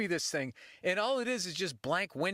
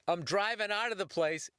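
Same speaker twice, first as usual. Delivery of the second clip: usual speaking voice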